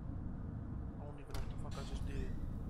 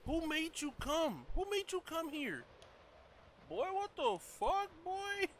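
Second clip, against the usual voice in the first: mock high teasing; 12 semitones higher, slower than usual. Mocking the game character.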